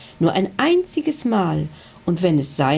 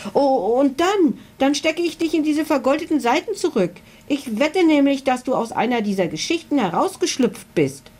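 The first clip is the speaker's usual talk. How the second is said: put-on high-pitched, rushing girl's voice; shrill voice; 8 semitones higher than usual. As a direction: squeak it out, high and excited. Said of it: "fuhr Emily mit schriller Stimme fort".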